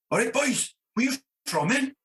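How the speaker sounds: high, sing-song Welsh Valleys voice; Welsh Valleys accent. Retold or imitated. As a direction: mimic a Merthyr stagehand's Welsh lilt. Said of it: "all the people I know talk like that".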